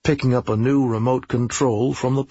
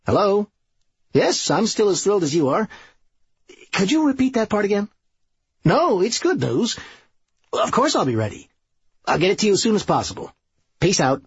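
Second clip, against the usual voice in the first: higher, brisk, chipper phone chat; eager Serge on the phone; 9 semitones higher, faster than usual. Acted. Serge reassures the caller about the book, eager and brisk. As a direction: pitch it up, talk fast and agreeable.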